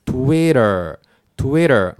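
TWITTER as "TWEH-der" with tapped T and R ending; English pronunciation. Shown as a demonstration. Teacher putting the sounds together, American pronunciation. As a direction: round the lips, then TWITTER smoothly, American-style. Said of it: "そうすると".

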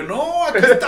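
laughing reveal. Retold or imitated. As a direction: show it off while laughing. (riéndose, más agudo y fuerte)

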